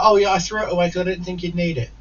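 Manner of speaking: quick, high put-on excuse; mocking house sitter. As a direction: rattle it off in a high mimicking voice.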